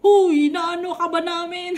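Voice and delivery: loud, high imitation of a telling-off; hammed-up indignant scolder; mock scolding. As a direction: shout the rebuke, about to burst out laughing. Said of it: "pinagalitan na ako".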